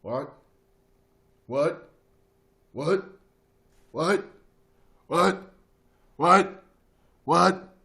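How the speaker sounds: short questioning yelps that build; escalating "what?". Acted. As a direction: keep firing rising whats, building to a shout.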